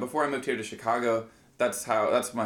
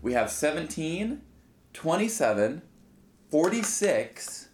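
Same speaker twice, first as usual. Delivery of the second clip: slow, drawn-out number announcement; slow dramatic reading; 4 semitones higher, slower than usual. Acted. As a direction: read each number like a big reveal. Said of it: "Read them out for the listeners just one more time".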